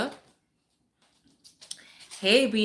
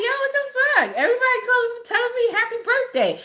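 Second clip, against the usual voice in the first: very high, incredulous retold outburst; high-pitched, exaggerated bafflement; baffled reaction; 11 semitones higher, faster than usual. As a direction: go way up high in mock disbelief. Retelling her baffled reaction.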